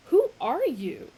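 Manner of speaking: startled angry challenge. A narrator's reading. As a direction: demand it angrily. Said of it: "rising angrily".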